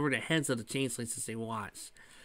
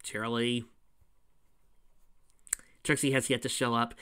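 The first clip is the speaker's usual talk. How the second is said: ordinary delivery, nothing marked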